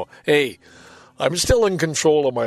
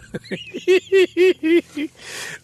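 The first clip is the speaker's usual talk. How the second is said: long high giggling laugh; can't stop laughing at the report; real laughter; 4 dB louder than usual. Real, not acted. Patient reading his glowing exam, laughing helplessly. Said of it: "I don't know why I'm laughing".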